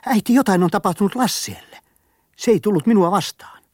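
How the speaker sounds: high, loud, alarmed cry to his mother; boy rushing home calls out to his mother, alarmed and loud. Acted. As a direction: burst in calling for mom in alarm. Boy bursts in calling mother.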